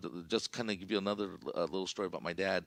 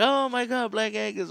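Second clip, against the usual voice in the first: much higher, louder squeal of fake amazement; mocking classmates; 13 semitones higher, 9 dB louder than usual. Classmates mocking [name]'s fancy steak place, high mocking squeal. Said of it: "just started making fun of me".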